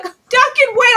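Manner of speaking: high, loud call after him. Retold or imitated. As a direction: call out to stop him leaving. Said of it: "She's like".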